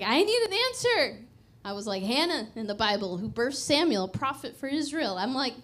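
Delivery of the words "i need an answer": high, loud demand to God; confrontational. Retold or imitated. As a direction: re-enact an insistent plea for an answer. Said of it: "I got confrontational with him".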